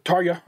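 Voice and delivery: Tarja said TAR-yah, J like a Y; corrected pronunciation, TAR-ya. Shown as a demonstration. Host fixing their own slip, [name] said as TAR-yah. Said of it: "I said [name], I know".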